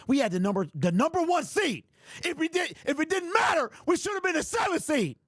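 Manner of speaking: raging fan outburst; furious shout. Real, not acted. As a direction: yell it, livid. Furious rant about the season.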